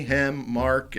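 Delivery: ordinary delivery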